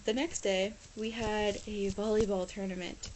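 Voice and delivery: usual speaking voice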